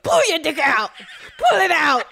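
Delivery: high, loud mock-furious order; angry command. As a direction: yell it like a comic furious demand. Acting out angry oral sex.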